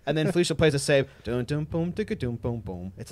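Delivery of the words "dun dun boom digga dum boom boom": mouth bass line. Retold or imitated.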